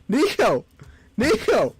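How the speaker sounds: high, loud call of the name. Acted. Player calling Nico to hurry, shouting. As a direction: yell after him.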